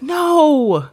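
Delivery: put-on high, loud childlike plea; a small child begging no; little girl crying out no; inner child pleading. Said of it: "some little child part of me that just felt like".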